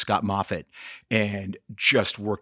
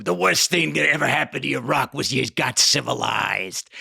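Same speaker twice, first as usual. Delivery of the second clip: put-on gruff old trainer Mick, louder; imitating Mick; 3 semitones higher, 4 dB louder than usual. Trainer Mick scolding Rocky, a hammed-up gruff voice. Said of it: "Mick says to him".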